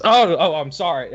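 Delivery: quick, flustered "Oh, oh, I'm sorry"; startled apology. Acted. Oscar walking in on Manda, startled apology. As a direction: caught off guard, blurting an apology. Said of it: "and he goes".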